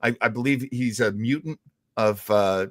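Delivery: ordinary delivery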